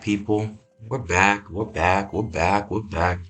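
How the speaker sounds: ordinary talk, nothing marked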